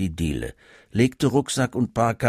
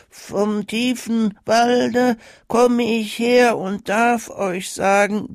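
put-on high, very slow, sing-song child reciting a poem; mechanical child recital; 12 semitones higher, 4 dB louder, slower than usual. A narrator's reading. Child recites the Christmas poem to the Santa, mechanical and high. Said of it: "mechanisch seinen Vortrag".

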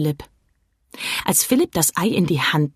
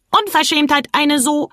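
scolding; 8 semitones higher than usual. A narrator's reading. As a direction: tell the boy off in outrage. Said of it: "schimpfte der Plappergei".

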